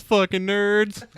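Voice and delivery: high, drawn-out heckle; imitated shout. Guy under the car heckles the band, mock shouting. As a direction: holler it slowly in a high mock yell. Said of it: "he shouts".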